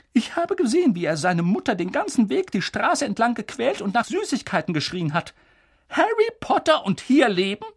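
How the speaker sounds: very high, loud, scandalised professor; McGonagall, outraged. Acted. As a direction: protest vehemently at a high pitch. Narrator voices outraged McGonagall. (schrill hohe, empörte Professorin)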